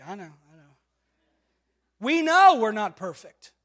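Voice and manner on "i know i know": ladies muttering. Retold or imitated. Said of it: "Some of you ladies were like".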